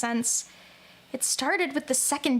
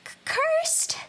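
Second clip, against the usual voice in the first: startled stammer. Acted. Character startled by curses.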